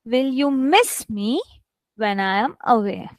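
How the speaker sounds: "miss" stretched and stressed. Shown as a demonstration. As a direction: lift "miss me" and drop down for "when I am away". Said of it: "Here I'm stressing".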